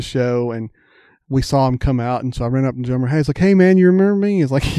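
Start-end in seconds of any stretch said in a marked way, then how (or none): 3.4-4.4 quick, louder eager greeting; eager fan. Retold or imitated. Fan running up to the drummer, eagerly excited. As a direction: rush it out like an excited kid.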